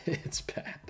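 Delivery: the same verdict whispered. Acted. To the audience, a whispered aside. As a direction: drop the voice to a whisper.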